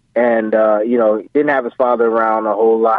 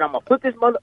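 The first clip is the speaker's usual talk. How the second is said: raised-pitch imitation of dad's threat, speeding up; threatening dad; 9 semitones higher, faster than usual. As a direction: rush out the warning as an intense father. Retold dad threatening his son.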